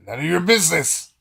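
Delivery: high, loud acted outburst; hammed-up defensive shout; indignant retort. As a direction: fire it back indignantly. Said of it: "he's like".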